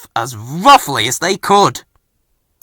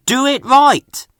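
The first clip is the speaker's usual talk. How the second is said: higher, louder, slow "right" falling; stern rule; 13 semitones higher, 5 dB louder than usual. A narrator's reading. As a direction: spell the rule out slowly. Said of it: "Fergus told Bill and Ben".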